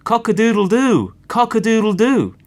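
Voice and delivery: high, loud rooster crow; cock crowing. A narrator's reading. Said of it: "the cock crowed".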